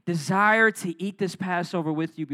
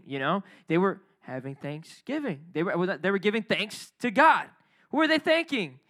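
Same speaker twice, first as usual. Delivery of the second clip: ordinary delivery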